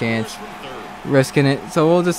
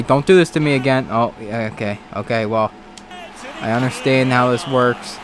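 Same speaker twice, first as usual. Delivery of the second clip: ordinary talk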